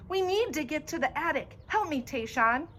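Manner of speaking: high, urgent shouted plea; desperate shout; screamed plea. A narrator's reading. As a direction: yell at him for help. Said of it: "I scream at him".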